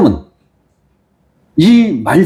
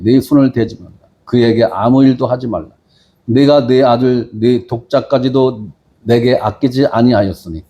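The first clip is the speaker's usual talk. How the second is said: measured, lower Bible reading; scripture reading; 5 semitones lower than usual. Acted. Pastor reads Genesis to the congregation, solemn reading. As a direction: read the verses with solemn weight.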